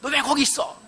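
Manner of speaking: mother's mock angry scolding; scolding shout. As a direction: yell at the lost boy. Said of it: "이러면서 호통을 쳤을 겁니다".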